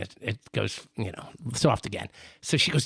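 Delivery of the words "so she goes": ordinary delivery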